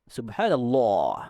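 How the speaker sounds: "subhanallah" slow and low, last vowel held back in the throat; throaty, wrong. Shown as a demonstration. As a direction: swallow the long a of "subhanallah". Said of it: "nggak begitu".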